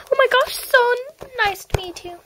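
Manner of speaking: high gushing greeting; thrilled fan voice; starstruck fan. Acted. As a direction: gush over meeting your idol. Starstruck fan meets Molly.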